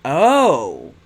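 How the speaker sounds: loud sudden oh of surprise; surprised. Real, not acted. Gamer reacting to a game twist, loud surprised oh. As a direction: caught off guard, blurt it out.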